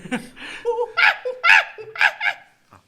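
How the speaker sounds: loud, very high monkey whoops; piercing primate hooting; monkey screech impression. Shown as a demonstration. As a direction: shriek like an excited monkey. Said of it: "really good monkey impression".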